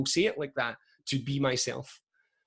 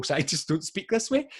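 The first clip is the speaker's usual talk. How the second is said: ordinary talk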